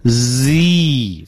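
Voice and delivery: "Z" as a long continuous buzz, first into ee; drawn-out buzzing "Z"; unblocked Z. Shown as a demonstration. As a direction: let air flow through "Z". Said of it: "气流不被堵住".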